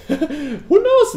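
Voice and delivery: ordinary talk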